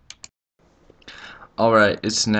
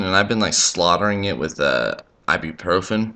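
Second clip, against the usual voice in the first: usual speaking voice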